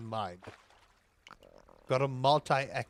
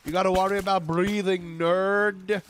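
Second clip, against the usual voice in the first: mock taunt; 4 semitones higher, 7 dB louder than usual. Mock scolding about breathing in space.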